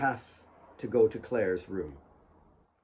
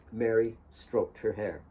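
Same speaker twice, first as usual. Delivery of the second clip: usual speaking voice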